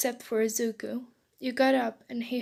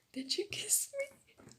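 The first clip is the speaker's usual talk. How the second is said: tiny, very high squeal; much higher and softer, giddy squeak; 10 semitones higher, 9 dB quieter than usual. Acted. Boy alone in bed recalling the kiss, squealing. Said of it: "he squirreled".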